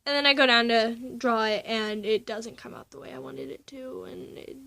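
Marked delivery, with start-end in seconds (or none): 2.6-4.6 whispered. Real, not acted. Whispered fragment.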